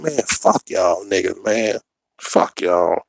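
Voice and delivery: low, slow, under-the-breath contempt; eye-rolling dismissal. Retold or imitated. Recruit rolling his eyes at screaming instructors, quiet weary dismissal. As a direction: brush them off quietly, dropping the pitch. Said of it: "I'm just rolling my eyes like".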